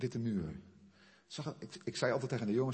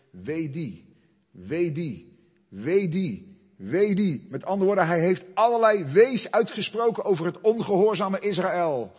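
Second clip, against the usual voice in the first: rising louder and higher with each wee die; building woe cries; 7 semitones higher, 13 dB louder than usual. Acted. Preacher thunders Isaiah's woes over disobedient Israel. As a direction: pile the woes on, climbing to a shout.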